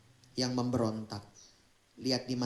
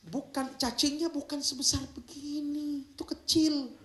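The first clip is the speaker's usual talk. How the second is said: high squeal; 13 semitones higher than usual. Acted. Correcting the size of the worms.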